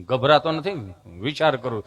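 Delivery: low, hesitant mock stammer, catching before words; stammering friend. Friend answering the nurse, imitated low stammer. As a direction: hum and stick, then answer low. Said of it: "જીભ જલાઈ ચોંટે અટકે".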